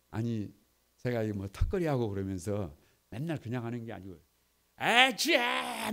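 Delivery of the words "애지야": very slow, stretched, higher call; straining shout during pull-ups; self-cheer cry. Retold or imitated. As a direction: drag the cheer out while straining. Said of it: "막 이러면서 막 턱걸이를 한단 말이에요".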